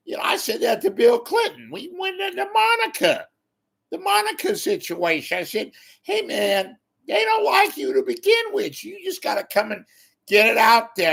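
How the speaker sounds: high, loud ranting voice; hammed-up Carville rant; Carville impression. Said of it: "I could be like Carville".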